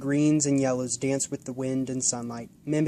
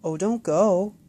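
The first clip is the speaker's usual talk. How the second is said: disappointed plea; 6 semitones higher, 6 dB louder, slower than usual. Acted. Boy begging the bird to stay, disappointed. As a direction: plead as the bird leaves.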